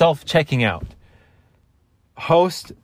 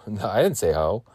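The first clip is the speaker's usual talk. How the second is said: usual speaking voice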